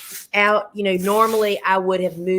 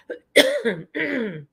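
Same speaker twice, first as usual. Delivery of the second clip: throat clearing; 7 semitones higher than usual. Real, not acted. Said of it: "I'm losing my voice".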